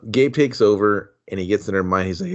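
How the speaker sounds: normal talking voice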